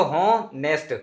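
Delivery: honest said slowly with a break between hon and est; broken pronunciation. Shown as a demonstration. Teacher demonstrating the break in honest, slow. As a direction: split honest into two slow halves. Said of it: "हम break देते हैं pronunciation करते वक्त".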